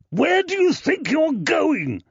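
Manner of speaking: loud, high angry yell. Acted. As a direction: yell after them furiously. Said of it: "Tobias yelled after them".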